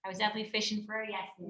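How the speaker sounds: ordinary delivery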